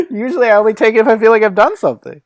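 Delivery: fast, loud, laughing words; laughing through words. Real, not acted. Barkeep explaining the tip to the cop, laughing hard. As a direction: talk fast while still laughing. Laughing while talking in character.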